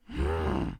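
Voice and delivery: deep sultry hmm; low seductive hum. Acted.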